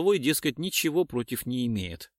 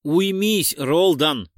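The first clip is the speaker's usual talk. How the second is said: louder, higher order to stop; sharp command; 5 semitones higher, 6 dB louder, much slower than usual. A narrator's reading. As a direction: rein in an unruly partner loudly. Narrator orders an aggressive partner down. (громкий резкий окрик)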